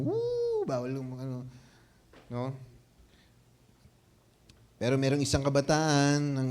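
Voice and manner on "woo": a sudden high woo like a sports fan; the cheer you can't do at tennis, high woo; mock fan cheer. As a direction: squeal out a short crowd whoop. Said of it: "di ka pwedeng mag-ano".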